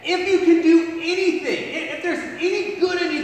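father begging Jesus for pity; pleading. Acted. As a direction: beg as if it's the last chance.

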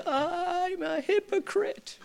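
high soft cry, slow; tearful mock cry of hypocrite. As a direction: break as you admit it. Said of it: "And I'm like".

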